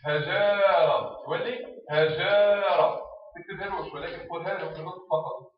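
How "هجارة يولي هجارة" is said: هجارة stretched very slow, long a on جا; drawn-out syllables. Shown as a demonstration. Teacher showing the vowel moving to the next letter, very slow. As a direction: slide the vowel onto the جا in هجارة. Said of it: "شو يولي نقول؟".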